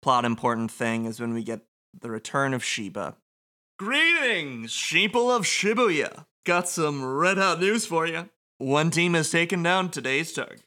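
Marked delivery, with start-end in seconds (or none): 3.8-10.6 high, showy game-host voice; announcer voice. Acted. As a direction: hype up the announcement like a showman. Reading villain announcer's lines.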